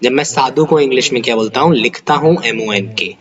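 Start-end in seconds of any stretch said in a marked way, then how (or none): none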